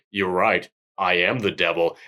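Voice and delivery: low, understated devil reveal; old Japanese man. Acted. Reviewer acting the old man's confession in the cave, low. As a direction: drop deep and confess it quietly. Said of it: "he goes".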